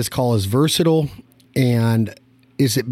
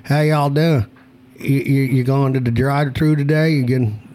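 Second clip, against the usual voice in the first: mock Southern accent; 4 semitones higher than usual. Teasing the guest about not moving his mouth, mock Southern accent. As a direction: do a lazy-lipped Southern accent. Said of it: "Do you move your mouth when you talk?".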